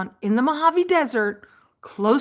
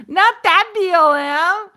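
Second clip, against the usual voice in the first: emphatic correction; 6 semitones higher, 6 dB louder, slower than usual. Real, not acted. Correcting his own mix-up.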